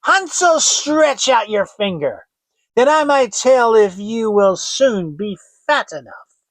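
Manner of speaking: high, loud witch call. Acted.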